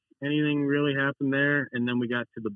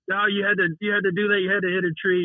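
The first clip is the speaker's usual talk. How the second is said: loud, higher, exasperated scolding; retold angry yelling; 8 semitones higher, 4 dB louder than usual. Racer chewing out teammate who hit a tree, angry yelling. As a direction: yell at him, pissed off. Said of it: "just yelling at him".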